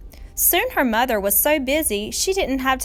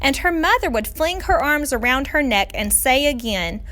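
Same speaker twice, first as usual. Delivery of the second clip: usual speaking voice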